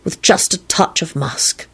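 smitten, lingering murmur; love-struck. A narrator's reading. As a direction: savour each scent dreamily. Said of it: "in a love-struck voice".

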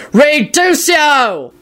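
high, drawn-out incantation cry; screamed spell. A narrator's reading. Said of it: "Kingsley screamed".

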